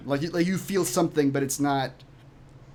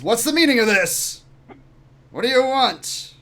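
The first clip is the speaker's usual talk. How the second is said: loud, raised demanding voice; indignant captain; 8 semitones higher, 7 dB louder than usual. Acted. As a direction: challenge the intruder loudly. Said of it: "Zelen stands up, says".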